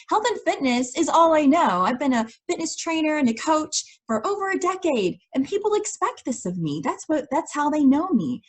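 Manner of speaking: high-pitched, mock-defensive protest; retold defensive insistence. Coach defending the career to a friend, retold protest. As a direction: justify yourself, pitched well up.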